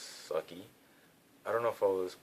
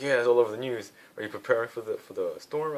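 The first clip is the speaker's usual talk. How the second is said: friend's news blurted, higher and louder; a friend bursting with the storm news; excited friend; 6 semitones higher, 10 dB louder than usual. Retold or imitated.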